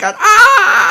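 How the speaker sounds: long, high-pitched loud scream; drawn-out demonstration scream; acted scream. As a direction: scream like someone in a panic. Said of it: "he's screaming and yelling".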